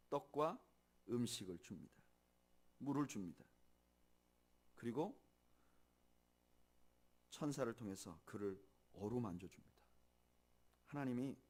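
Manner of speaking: ordinary talk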